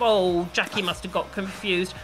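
ordinary delivery

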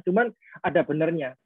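usual speaking voice

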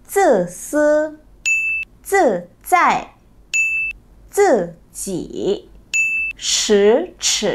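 Zi si zi zai zi ji shi chi with retroflex vowel ư; teacher models each syllable slowly; 中文发音示范; Chinese syllables demo. Shown as a demonstration. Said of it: "chúng mình sẽ cùng đọc một vài ví dụ".

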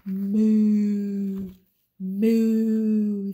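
loud, long drawn-out moo; cow moo. Acted. Cow moos in picture book.

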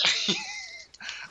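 high-pitched cracking-up laugh; squealing giggle; real laugh. Real, not acted. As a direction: burst out laughing at your own joke. Cracks up at own punchline.

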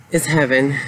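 ordinary talk, nothing marked

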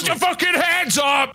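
barking command. Acted. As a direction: scream it like a stick-up. Robbery at gunpoint.

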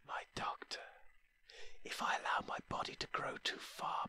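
faint breathless whisper of a dying plant spirit; barely-there whisper, fading as you go; soft eerie creature whisper throughout; ghostly whisper. Acted. Dying plant-being Risha speaks.

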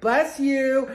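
loud, high, sing-out blessing; high, ringing mock blessing; pointed call-out. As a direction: call it out sweetly over-loud as a parting shot. Calls out to rude woman.

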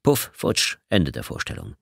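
usual speaking voice

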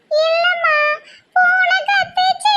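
very high, slow wail; squeaky tearful fib; crying while talking. Acted. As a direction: deny it while bawling, dragging the last word. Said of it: "அழுதுகிட்டே சொல்லுத".

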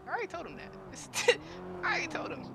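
high, strained retold plea; high-pitched protest. Exasperated protest about being single.

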